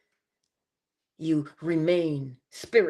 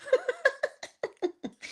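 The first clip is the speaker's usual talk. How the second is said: high burst of laughter; 3 dB quieter than usual. Real, not acted. Host cracks up at own joke.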